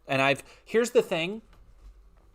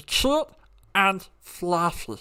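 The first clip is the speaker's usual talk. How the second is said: high, slow alien creature voice; Stitch voice; 4 semitones higher, 4 dB louder, much slower than usual. Retold or imitated. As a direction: squeak it like a small alien. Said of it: "I can do Stitch".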